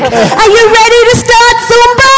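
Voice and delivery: high-pitched hammed-up aerobics host; enthusiastic Zumba teacher. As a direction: pump up the class like a Zumba teacher. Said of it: "the Zumba teacher, is really enthusiastic".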